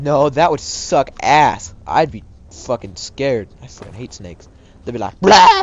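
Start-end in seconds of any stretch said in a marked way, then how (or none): 5.2-5.6 high, loud sudden "blah"; imitated monster jump-scare yell; mock snake attack. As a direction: burst out like a lunging snake. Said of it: "Probably in that exact tone".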